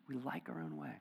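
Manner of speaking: soft confessional echo; quiet repeat. Shown as a demonstration. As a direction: drop "We like our own way" to a soft echo.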